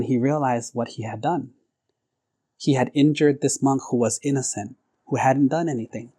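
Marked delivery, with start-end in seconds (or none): none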